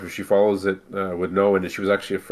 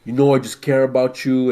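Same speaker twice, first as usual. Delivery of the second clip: imitating the brother's thick Italian accent, pitched higher; mock-earnest brother with an exaggerated Italian accent; 5 semitones higher, 4 dB louder than usual. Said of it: "such a thick Italian accent".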